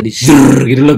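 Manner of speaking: a buzzing zrrr for shivers; goosebump buzz. Retold or imitated. Goosebumps when talking to foreigners.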